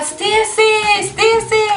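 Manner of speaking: high calling voice, drawn out. Retold or imitated. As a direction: call her name up the hill. Calling out for Stacy.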